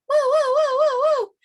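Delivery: high, loud wailing siren imitation; be the fire engine siren; fire truck siren. Shown as a demonstration. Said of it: "What does a fire truck sound like?".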